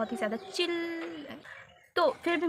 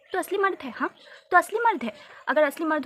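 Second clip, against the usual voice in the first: sarcastic; 5 semitones higher, 6 dB louder than usual. Real, not acted. Sarcastic jab at fake-cut boy.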